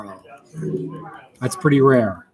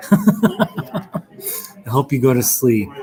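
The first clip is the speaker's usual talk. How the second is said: laughing slowly; much slower than usual. Real, not acted. Teasing joke about boring viewers.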